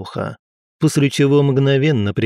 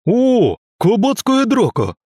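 high, loud delighted roar; 11 semitones higher, 4 dB louder, slower than usual. Acted. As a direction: bellow it out gleefully. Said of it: "раздался рёв гусара Медведева".

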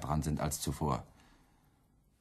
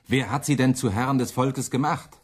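indignant outcry; 8 semitones higher, 7 dB louder than usual. Acted. Müntzer confronts Luther.

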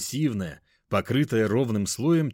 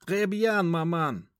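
mock French; 9 semitones higher, slower than usual. A narrator's reading. Сын отвечает матери по-французски.

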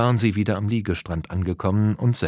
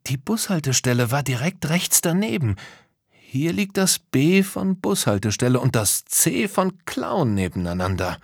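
raised, keen character voice; Tom, puzzling it out; 6 semitones higher than usual. Acted. Tom explains the clue to his friends, keenly puzzling. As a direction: work through the clue in a higher voice.

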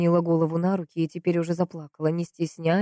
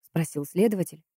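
plain reading voice

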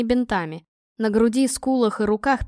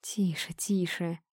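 very soft, lower-pitched calming words; soothing hush; 4 semitones lower, 9 dB quieter, slower than usual. A narrator's reading. Injured man calms the angry girl, soothing. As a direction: hush her tenderly, barely voiced. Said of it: "улыбнулся разбитыми губами Макс".

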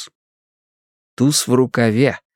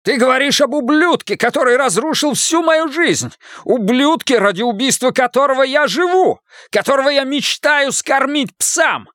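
much higher, furious outburst; explosive rage; 14 semitones higher than usual. Acted. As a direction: explode at him in fury. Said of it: "Клинтер взорвался".

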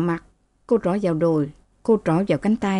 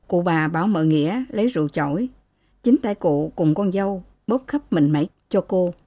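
ordinary delivery, nothing marked

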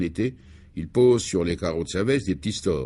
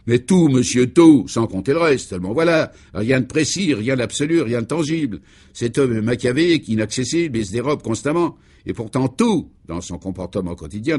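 raised, louder voice of a flustered security chief; exasperated chief; 4 semitones higher, 5 dB louder than usual. Acted. The security chief vents to the reporters, loud and exasperated. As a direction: pour out the frustration in a loud, worked-up rush. Clément pleads his exasperation.

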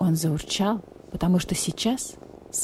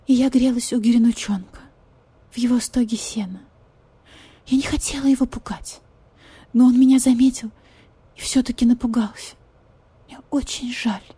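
higher voice, breaking into whispers; pitiful pleading cat lady; 5 semitones higher than usual. Acted. Marquise pleads, whispered parts.

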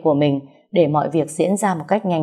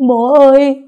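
loud, high, drawn-out cry; despairing wail; 9 semitones higher, 10 dB louder, slower than usual. A narrator's reading. Daughter screaming for her dead father in despair. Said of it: "gào trong tuyệt vọng".

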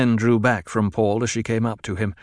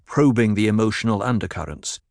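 ordinary delivery

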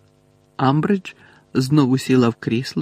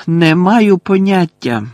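loud, raised, slow denial; loud reply; 6 semitones higher, 8 dB louder, slower than usual. A narrator's reading. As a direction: answer loudly and firmly. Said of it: "голосно відповів Гаррі". (голосно й твердо відповісти)

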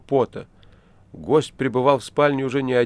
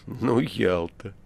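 indifferent; much slower than usual. A narrator's reading. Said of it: "бездушный курильщик".

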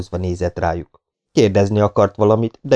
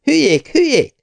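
climbing higher and higher with each hülyék; furious rising insult; 13 semitones higher than usual. Acted. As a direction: hurl hülyék ever higher. Furious woman insulting men.

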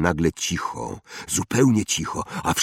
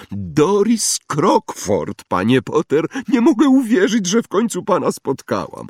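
high, thrilled greeting; overexcited fan, pitch soaring; gushing admirer; 12 semitones higher, 5 dB louder than usual. Acted. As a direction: gush at meeting a celebrity. Admirers rush to greet Harry.

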